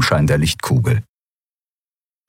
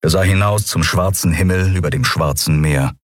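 usual speaking voice

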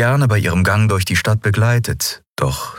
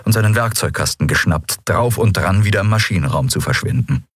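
ordinary delivery

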